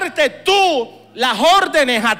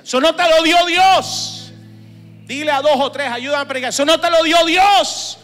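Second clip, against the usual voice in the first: loud, ringing pulpit proclamation; preaching at full force; fervent declaration; 2 semitones higher, 6 dB louder than usual. Real, not acted. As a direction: declare it over the congregation.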